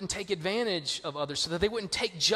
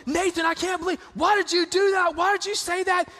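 high, sharp mock scolding; imitating an exasperated mom mid-rant; furious mother voice, pitched way up; mom yelling; 11 semitones higher, 4 dB louder than usual. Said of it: "she's upset".

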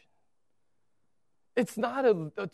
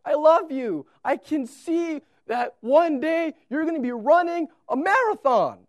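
loving Father urging; 8 semitones higher, 8 dB louder than usual. Pretend. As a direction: pour out fatherly love and belief. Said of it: "he's your Father saying".

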